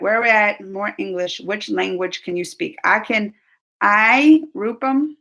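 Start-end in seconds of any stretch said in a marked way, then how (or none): none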